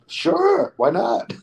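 high, easygoing yes; eager agreement. Retold or imitated. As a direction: pitch shoots up on a breezy acceptance. Retold eager yes to an offer.

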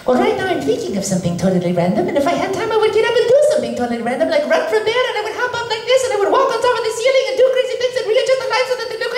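very high, fast cartoon Italian accent, laughing all through; a giddy Italian accent, racing ahead. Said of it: "in the Italian voice".

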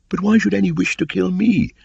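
put-on high, loud boyish voice; a puzzled young boy asking; young Mowgli. A narrator's reading.

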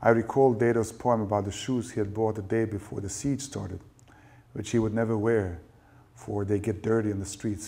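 plain reading voice

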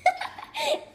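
squealing giggle fit; high shrieking laugh. Real, not acted. Laughing fit at booger bean.

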